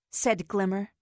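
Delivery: ordinary delivery, nothing marked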